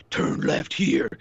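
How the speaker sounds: slow, whispered gravel of the caped hero; Batman voice. Retold or imitated. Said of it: "the accentuated version of Batman".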